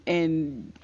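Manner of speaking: normal talking voice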